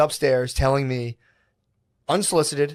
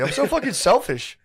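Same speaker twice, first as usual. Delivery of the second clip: higher, hammed-up self-blame; mock guilt; 9 semitones higher than usual. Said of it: "I'm like".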